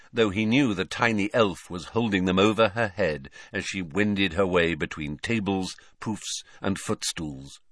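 usual speaking voice

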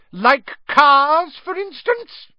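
high, pointed, loud challenge; accusing wife. Acted. Wife catching out her husband, high accusing challenge. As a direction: cut in sharply with an accusing question.

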